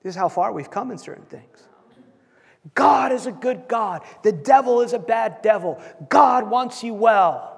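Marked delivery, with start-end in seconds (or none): 2.7-7.5 slowed, emphatic pulpit proclamation; slow preaching emphasis. Acted. Preacher proclaiming God's goodness, slow and emphatic. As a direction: preach each short line deliberately.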